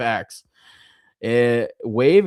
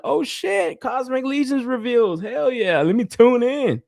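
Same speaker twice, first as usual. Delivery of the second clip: high-pitched eager reaction; excited self-quote; 12 semitones higher than usual. Retold or imitated. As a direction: play up the thrill of spotting big news. Re-enacting excitement at seeing reveals.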